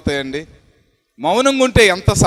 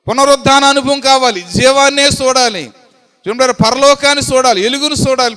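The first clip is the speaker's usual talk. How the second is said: higher, louder exhortation; preaching at full force; 3 semitones higher, 4 dB louder than usual. Acted. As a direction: list each sin like a warning. Preacher lists sins, exhorts. (ఆవేశపూరిత ప్రసంగం)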